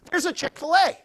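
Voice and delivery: high, loud cry of delight; excited discovery. Retold or imitated. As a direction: sing out like a hungry kid who spotted lunch. Spotting the billboard on the road.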